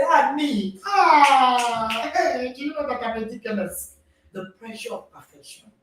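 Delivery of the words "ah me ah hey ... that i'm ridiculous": higher mock-exasperated parent. Parent mocking a child who made a mistake, higher and hammed-up. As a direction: scold a child with hammed-up dismay. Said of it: "and say".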